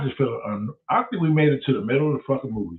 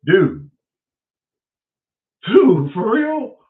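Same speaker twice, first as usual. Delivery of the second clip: exasperated cry; 5 semitones higher, 7 dB louder, much slower than usual. Retold or imitated. Exasperated at friend.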